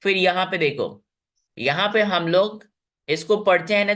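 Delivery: normal talking voice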